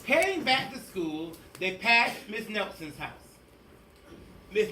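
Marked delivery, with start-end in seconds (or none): none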